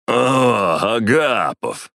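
put-on high, loud drunken greeting; slurring drunk man calling out. A narrator's reading. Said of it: "раздался пьяный голос Орлова".

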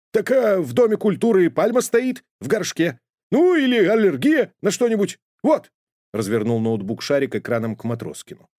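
high, quick know-it-all dog; high eager puppy voice; eager dog Sharik. A narrator's reading. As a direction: blurt the diagnosis brightly. Sharik argues with laptop.

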